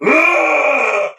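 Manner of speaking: hammed-up pissed-off roar; angry monster grunt. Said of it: "fucking pissed".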